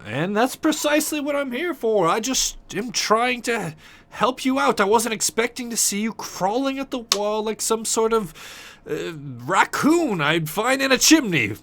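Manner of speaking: high-pitched in-character retort; exasperated visitor. Acted. Player argues back in character.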